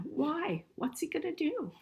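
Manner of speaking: ordinary delivery, nothing marked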